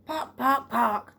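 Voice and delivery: hammed-up clucking in a changed voice; mock chicken clucking. Mr Ellis imitates a chicken.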